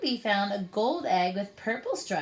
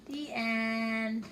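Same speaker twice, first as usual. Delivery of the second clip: ordinary delivery, nothing marked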